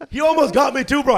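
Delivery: ordinary talk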